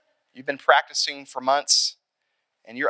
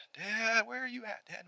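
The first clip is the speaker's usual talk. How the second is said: anxious searching; 8 semitones higher, 11 dB quieter than usual. Acted. Nervous player searching for dad.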